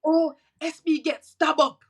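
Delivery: high, loud, slow mock Jamaican outcry; extra Jamaican reaction. Jamaican relatives hearing of the stabbing, hammed-up Jamaican alarm. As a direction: imitate over-dramatic Jamaican relatives wailing the news. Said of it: "they're extra. Like, it, when they're hearing it".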